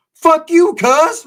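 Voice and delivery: high, loud mock yell back; shouting back at the cellmate who turned on him; retold yell. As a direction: fire it back at him, hammed-up. Retorts to cellmate in story.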